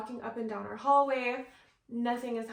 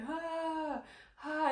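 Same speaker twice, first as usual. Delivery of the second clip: dying cow moan; 6 semitones higher, 3 dB quieter than usual. Retold or imitated. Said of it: "I sound like a dying cow".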